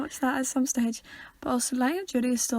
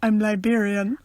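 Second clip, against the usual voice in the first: Vine quote; 4 dB louder than usual. Retold or imitated. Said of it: "my personal favourite is".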